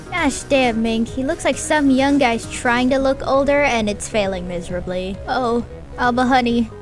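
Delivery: ordinary delivery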